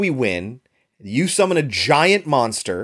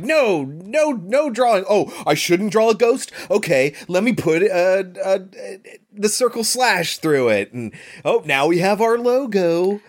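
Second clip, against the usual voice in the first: higher-pitched, thinking aloud in mock discovery; a hint of a made-up designer, pitched up; lightly voiced logo designer; 7 semitones higher than usual. Film's makers dreaming up their logo, lightly mocked.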